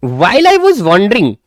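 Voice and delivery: slow, deliberate textbook reading; slow reading aloud. Shown as a demonstration. Teacher reading the story slowly to students. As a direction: read each word out slowly for the class.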